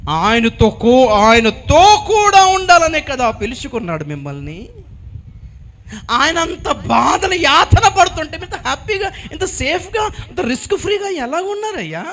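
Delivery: high, forceful acted scolding; impassioned reproach. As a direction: take the disciples to task as an aggrieved mother. Mother reproaches the disciples in hiding.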